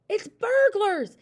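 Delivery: high, loud, slow stage hiss; panicked warning, stretched out; alarmed hiss. A narrator's reading. Mother warning family of burglars, alarmed hiss. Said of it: "hissed the mother".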